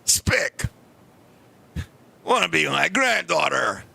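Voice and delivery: put-on low, slow old kung fu master voice; deep, deliberate mock grandmaster challenge; old kung fu grandfather. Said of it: "He came right up to me and said".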